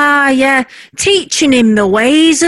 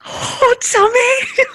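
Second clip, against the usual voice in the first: thrilled squeal; 9 semitones higher, slower than usual. Real, not acted. Wife thrilled by the lottery call.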